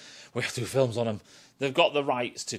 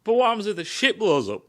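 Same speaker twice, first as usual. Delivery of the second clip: high, louder anxious voice; worried question; 9 semitones higher, 5 dB louder, faster than usual. Retold or imitated. Crew member questioning the alien plan, raised and worried. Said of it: "I was like".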